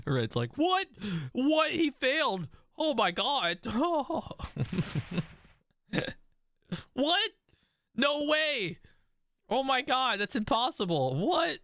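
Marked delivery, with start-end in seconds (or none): 0.6-3.6 high, loud squeal of disbelief; shocked disbelief. Real, not acted. Gamer reacting to a failed move, shrieking in disbelief. 3.6-5.2 high-pitched incredulous laugh; high laughter. Real, not acted. Gamer laughing at a failed move, high and incredulous. 6.9-11.6 very high-pitched astonishment; stunned disbelief. Real, not acted. Gamer seeing an impossible result, high-pitched and astonished.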